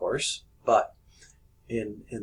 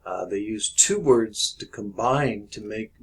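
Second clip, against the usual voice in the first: normal talking voice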